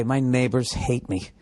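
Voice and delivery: usual speaking voice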